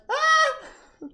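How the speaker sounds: high squeal that cracks; excited fangirl shriek, breaking high; voice cracking. Retold or imitated. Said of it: "My voice went".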